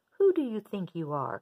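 loud indignant outburst; angry. Acted. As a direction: snap back haughtily. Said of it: "cried the Rainbow Fish".

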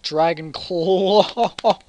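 very loud, drawn-out exclamation; slow, booming prize announcement; excited reveal. Real, not acted. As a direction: stretch it out into a big shout.

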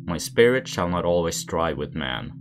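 plain reading voice

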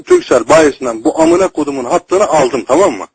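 normal talking voice

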